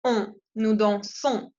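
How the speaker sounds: on said as a nasal vowel, then nous dansons; slow demo of the nasal on; French on sound. Shown as a demonstration. Teacher modelling the on ending for students. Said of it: "se pronuncia on".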